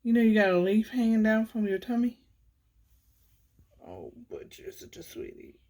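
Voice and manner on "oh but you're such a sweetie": soft whisper to the cat. Real, not acted. Whispering sweetly to the neighbour's cat. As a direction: breathe it tenderly, no voice.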